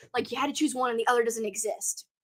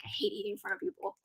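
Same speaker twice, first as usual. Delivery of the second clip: soft, whiny mock complaint, much quieter; mock whiny hush; 3 semitones lower, 12 dB quieter, faster than usual. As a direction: mumble it like a shy grumble. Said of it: "I'm like".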